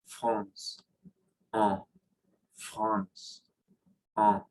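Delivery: "France" and "en" with nasal vowel, air through nose; slow drill of "France" and "en" nasalised. Shown as a demonstration. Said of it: "A N".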